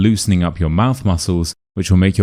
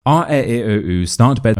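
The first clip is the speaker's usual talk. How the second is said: quick run-through of the vowels, pitched up; rapid vowel drill; 4 semitones higher than usual. Shown as a demonstration. Coach restarting the vowel drill, rapid rhythmic run. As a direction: reel off the sounds in one go. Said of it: "as many times as you can in a row".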